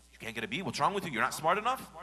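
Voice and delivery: loud, high reproach; scolding parent. Acted. Parent scolding son for a C grade. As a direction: berate the child's grade.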